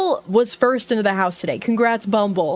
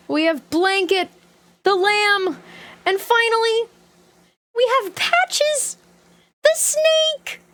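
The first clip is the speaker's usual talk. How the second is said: building excitement; 9 semitones higher, slower than usual. Real, not acted. Pitch building while announcing mascots.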